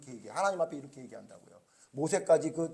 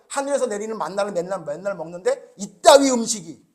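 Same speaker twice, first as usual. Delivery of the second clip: mock complaint; 3 semitones higher, 9 dB louder than usual. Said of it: "뭐라 그래요?".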